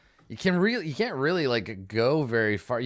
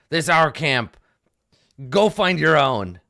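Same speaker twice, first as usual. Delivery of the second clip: loud, curt gnoll warning; louder territorial gnoll; 4 semitones higher, 8 dB louder than usual. Acted. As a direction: shoo the gnome away from camp. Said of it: "he shakes his head and he says".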